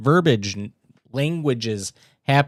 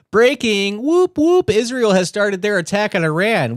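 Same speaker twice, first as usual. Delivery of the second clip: mock breaking news; 8 semitones higher, 4 dB louder than usual. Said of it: "reading MAGA Voice post".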